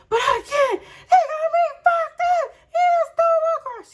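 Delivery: very high, loud frantic yell; screaming upset. Retold or imitated. Daughter protesting to her mom on the phone, screaming. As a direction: shriek it in outrage.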